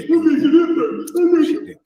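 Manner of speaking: high, shouted mock of men gossiping; mocking effeminate men. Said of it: "men talk so effeminate".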